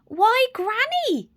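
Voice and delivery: high, slow and wondering; slow Little Red. Acted. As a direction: draw out each word in wonder. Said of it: "she said slowly".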